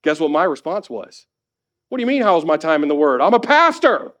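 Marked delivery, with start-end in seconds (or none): 1.9-4.1 quick indignant comeback, bursting high and loud on the end; frustrated retort. Retold or imitated. Husband retelling his comeback to wife's question, frustrated. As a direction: imitate yourself snapping back, voice jumping up. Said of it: "I got frustrated with her".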